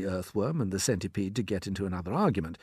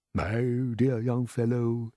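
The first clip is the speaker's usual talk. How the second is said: gentle; slower than usual. A narrator's reading. Grasshopper addressing young James, gently. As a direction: say it slowly and kindly. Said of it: "the old green grasshopper said gently".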